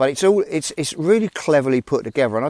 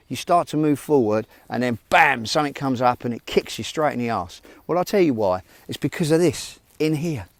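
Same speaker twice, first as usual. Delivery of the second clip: usual speaking voice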